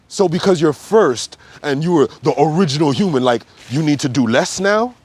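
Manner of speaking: normal talking voice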